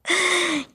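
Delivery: short, high, loud laugh at herself; self-mocking laugh. Real, not acted. Vlogger laughing at how boring she is, high laugh. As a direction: laugh it off, embarrassed. Laughing at her own boredom.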